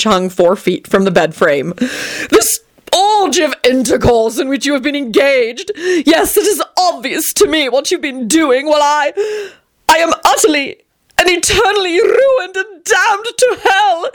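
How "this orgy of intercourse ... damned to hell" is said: howling lament. A narrator's reading. Friend howling at couple, very high theatrical sobbing. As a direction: howl like a tragic hero.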